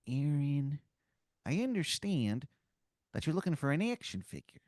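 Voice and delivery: lightly voiced Philly wizard leaning in; awful Philadelphia accent. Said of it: "his accent is just awful".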